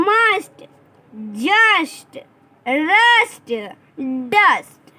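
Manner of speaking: loud pronunciation drill; read aloud loudly. Shown as a demonstration. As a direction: call each word out loud for the class. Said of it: "देखिए जोर जोर से पढ़ना है".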